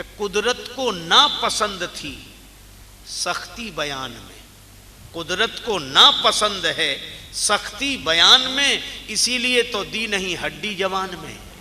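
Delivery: loud, drawn-out verse recitation; declaimed couplet. Real, not acted. Preacher quotes a poet on the boneless tongue, declaiming. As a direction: declaim it like poetry to a crowd.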